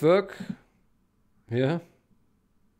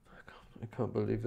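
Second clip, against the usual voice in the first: breathless whisper of disbelief; stunned whisper; 2 semitones lower, 10 dB quieter than usual. Real, not acted. Developer alone with the news, whispering in shock. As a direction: barely voice it, stunned.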